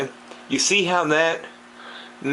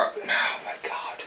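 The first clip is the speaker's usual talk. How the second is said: whispered under the breath; whispered dismay; 8 dB quieter than usual. Real, not acted. Fish keeper recounting his mistake, whispering in dismay. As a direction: breathe it out in despair.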